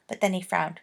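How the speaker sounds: ordinary delivery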